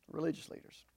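breathy whispered echo; whispered aside. Real, not acted. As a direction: drop it to a whisper for effect. Repeats phrase as an aside.

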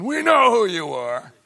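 high, loud demons' cry; we know who you are, stress on know; mock demon shout. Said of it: "without demons going".

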